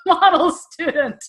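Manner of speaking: high, slow, giggly model student; mock herself through a laugh; tongue-in-cheek and amused; laughing irony. Real, not acted. Joking she was no model student.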